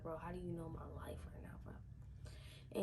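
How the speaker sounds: hushed, fast, amazed whisper; astonished whisper. Retold or imitated. Gibson stunned that the cult knows his life, whispered in awe. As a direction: breathe it out in disbelief, quickly.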